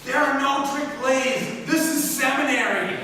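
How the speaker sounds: high, slow, indignant mock shout; mock screaming. Mad referee scolding seminary players over a trick play, mock screaming. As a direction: imitate an outraged referee, drawing out each word. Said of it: "He was screaming".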